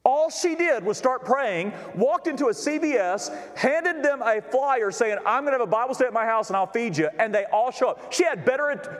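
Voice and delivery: high, ringing sermon delivery; full-force preaching. Real, not acted. Pastor telling the congregation about her full attendance, full-force preaching. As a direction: build the testimony at an urgent, high pitch.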